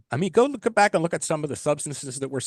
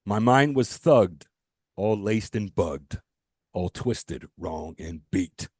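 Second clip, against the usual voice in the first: slow, lower spoken-word recital of song lyrics; reciting lyrics; 6 semitones lower, slower than usual. Shown as a demonstration. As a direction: read the lines slowly like a poem, pausing between phrases. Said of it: "let's do the lyrics real quick".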